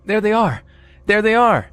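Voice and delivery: high-pitched excited cry, little added volume; narrated yelling. A narrator's reading. Passenger spotting the car behind, yelling in a high pitch. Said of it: "started yelling".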